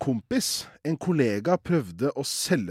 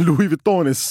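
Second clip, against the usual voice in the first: laughing; 3 semitones higher, 6 dB louder than usual. Real, not acted. Repeats fake brand laughing.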